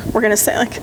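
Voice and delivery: usual speaking voice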